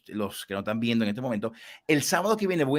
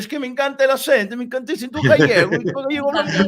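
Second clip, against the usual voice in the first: much higher and louder, gushing with delight; gushing excitement; 11 semitones higher, 6 dB louder than usual. Real, not acted. Host gushing to Alberto about the Galician accent, excited. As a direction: rave about it, voice soaring.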